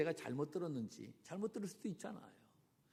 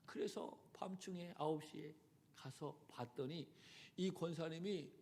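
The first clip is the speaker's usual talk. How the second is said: ordinary delivery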